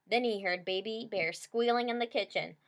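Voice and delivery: ordinary delivery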